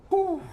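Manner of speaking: high exhaled whew of relief; relieved high whew. Real, not acted. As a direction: let out a squeaky relieved whew. Relief after escape.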